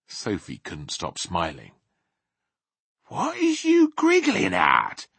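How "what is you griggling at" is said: high, drawn-out, put-out question; mildly offended giant; slightly nettled. A narrator's reading. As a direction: ask it a little peeved. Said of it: "slightly nettled".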